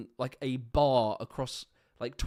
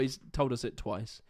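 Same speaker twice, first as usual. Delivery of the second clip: ordinary delivery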